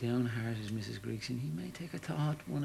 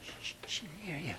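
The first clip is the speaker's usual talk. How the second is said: hushed warning; 4 semitones higher, 9 dB quieter than usual. Acted. Hushing him so wife won't hear.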